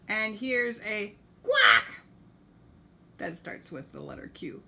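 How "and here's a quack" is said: excited shout. Acted. Excited reveal to children.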